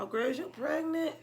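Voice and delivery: high, startled gossip voice; imitating a surprised girlfriend; mock shocked friend. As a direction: play a friend gasping at the news. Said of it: "you would have been like".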